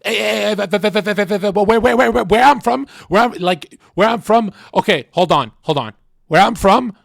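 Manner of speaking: loud, rapid stuttering impression of a hyped streamer; hammed-up hyped streamer stammer; xQc voice. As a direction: blurt it fast and loud, tripping over the words. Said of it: "let me do an X Q C's voice".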